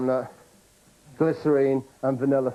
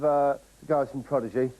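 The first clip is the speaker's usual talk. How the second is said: normal talking voice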